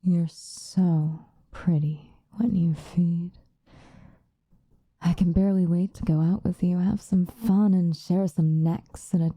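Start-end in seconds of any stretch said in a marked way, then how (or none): 0.0-3.3 very slow admiring whisper. Acted. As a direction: whisper it slowly, savouring. Admiring captive while feeding.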